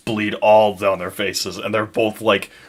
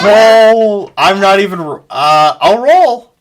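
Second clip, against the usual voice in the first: flustered outburst; 7 semitones higher, 8 dB louder, slower than usual. Real, not acted. Flustered exclamation at the table.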